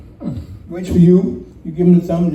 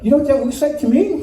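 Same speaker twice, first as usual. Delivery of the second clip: high, quick cartoonish shark pointing something out; lightly voiced shark, pitched up and eager; talking shark; 6 semitones higher, faster than usual. One shark to another spotting a swimmer, talking shark voice. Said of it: "one shark says".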